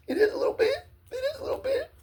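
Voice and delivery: high, tearful voice breaking; words squeezed out through tears; crying. Real, not acted. Insisting the mind is psychic, choking up.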